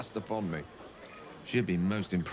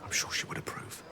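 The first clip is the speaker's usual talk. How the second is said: much softer, voiceless and fond; soft whisper; 4 dB quieter than usual. Acted. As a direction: let it fade to a whispered hope. Wizard ends memory of Tara.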